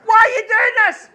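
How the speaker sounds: very high, loud desperate scream. Retold or imitated. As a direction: beg the nurses at full volume. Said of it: "standing screaming".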